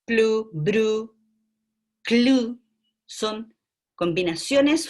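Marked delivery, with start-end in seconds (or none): 0.0-2.6 plu, bru, clu dieja dengan jelas dan makin keras; sounding out clusters. Shown as a demonstration. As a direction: lafalkan plu, bru, clu satu per satu dengan tegas. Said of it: "la combinación plu".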